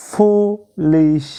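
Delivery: ফুলিশ whispered slowly as fu-lish; slow whispered demo of ফুলিশ; whispered syllables. Shown as a demonstration. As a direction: breathe ফুলিশ out in two slow parts. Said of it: "দুবারে উচ্চারিত হয়".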